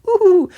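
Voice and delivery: high-pitched giddy "ooh-hoo"; excited ooh. Retold or imitated. Comic fan imitating their excited nerd brain, high giddy hoot. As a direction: squeal a high, delighted ooh. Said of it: "my little nerd brain is just".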